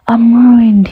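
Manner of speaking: loud dismayed cry; shouting. A narrator's reading. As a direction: cry it out in horror. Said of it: "he shouted".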